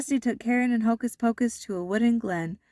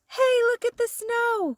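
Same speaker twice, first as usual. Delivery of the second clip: put-on high, excited kids' shout; children's delighted cry at the first snow; 3 dB louder than usual. A narrator's reading. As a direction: call out like thrilled children spotting snow. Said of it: "cried the children".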